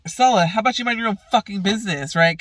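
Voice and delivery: fast, high telling-off; angry retort. Retold or imitated. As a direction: snap at her to back off. Retells angry row with Stella.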